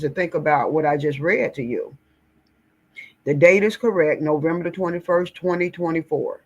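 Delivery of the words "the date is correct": ordinary talk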